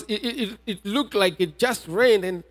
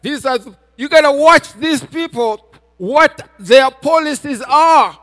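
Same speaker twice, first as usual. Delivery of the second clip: louder, higher-pitched sermon delivery; forceful preaching; 3 semitones higher, 6 dB louder than usual. Real, not acted. Preacher urging congregation to put things in order, forceful preaching. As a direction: drive each point home to the congregation.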